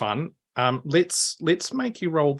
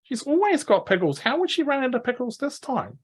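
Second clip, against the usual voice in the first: put-on high kid voice, baffled and hushed; indignant orc girl; 11 semitones higher than usual. Orc girl doubting Sally ran out of pickles, high and baffled. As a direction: refuse to believe it, half-whispered.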